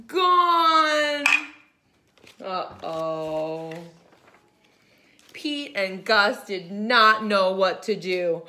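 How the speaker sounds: usual speaking voice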